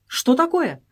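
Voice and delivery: a reader's voiced cry of surprise; startled exclamation. A narrator's reading. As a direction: blurt it out in alarm. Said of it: "одновременно воскликнули".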